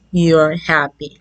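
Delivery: usual speaking voice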